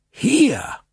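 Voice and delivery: very high, emphatic single word; dramatic exclamation. Acted. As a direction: proclaim it like a revelation. Count dramatically points out spot. (dramatisch ausgerufen, hoch)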